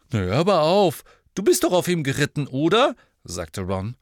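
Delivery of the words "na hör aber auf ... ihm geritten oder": loud, high, quick scoff; dismissive protest. A narrator's reading. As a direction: brush off the worry loudly and briskly. Ron brushes off Hermine's nerves.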